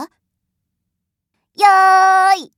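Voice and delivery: starting call. Acted. Calling start to servant.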